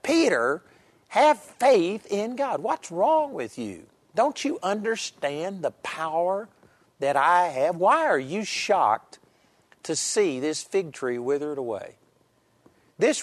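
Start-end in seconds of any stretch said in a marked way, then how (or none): 0.0-12.0 exasperated rebuke. Shown as a demonstration. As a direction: challenge Peter's disbelief with force. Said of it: "it was more like".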